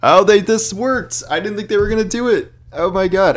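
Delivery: high, loud, amazed shout; can't believe it worked; shocked and thrilled. Real, not acted. Surprised reaction to change.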